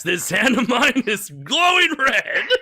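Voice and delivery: high, slow, building declaration; hammed-up anime hero. Fan reciting the shining finger speech, melodramatic hero voice.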